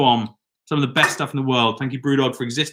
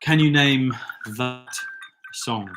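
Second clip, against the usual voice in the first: ordinary talk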